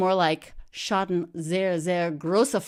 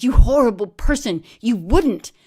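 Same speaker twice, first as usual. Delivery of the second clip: high, loud horrified scream; betrayed friend screaming, high and loud; 5 semitones higher, 12 dB louder than usual. Retold or imitated. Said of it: "she screamed".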